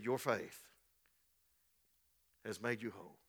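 tender, soft blessing. Retold or imitated. Jesus speaks softly.

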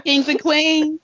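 high, bright show greeting; upbeat catchphrase. Acted. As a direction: hype up the audience.

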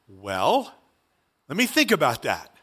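low, drawn-in "well" lifting into doubt; acted hesitation. Churchgoers weighing whether to go to Samaria, mock hesitant. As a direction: stall reluctantly, dropping the pitch. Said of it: "We start talking like this".

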